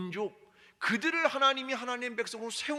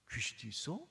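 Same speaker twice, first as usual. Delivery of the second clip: put-on skeptical child; 9 semitones lower, 9 dB quieter than usual.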